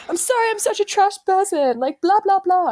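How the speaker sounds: high, whiny mock of the date; mocking whiny apology. Mocking the date's apology text, high and whiny. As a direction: whine it out to mock him.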